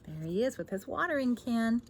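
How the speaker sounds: ordinary delivery, nothing marked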